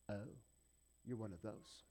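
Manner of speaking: low, hushed mock disapproval; judgmental aside. Preacher voices someone judging a tattooed newcomer, judgmental. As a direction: quietly size someone up and write them off.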